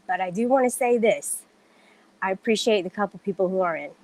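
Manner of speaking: ordinary delivery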